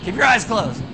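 preacher nudging listeners during the tale. Shown as a demonstration. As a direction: call it over the room. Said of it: "reminding listeners mid-story".

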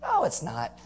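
high, soft dithering voice; confused neighbors. Retold or imitated. Neighbors puzzling over the healed man, high and dithering. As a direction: waffle back and forth, unsure. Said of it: "they're like".

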